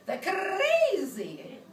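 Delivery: high, loud, hugely stretched word; hammed-up crazy. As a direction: blow it up into playful madness. Acting out list of townspeople.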